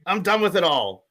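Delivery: hammed-up furious rant, shouted high; over-the-top angry fan ranting at the refs; angry reading. Shown as a demonstration. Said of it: "read it from an angry point of view".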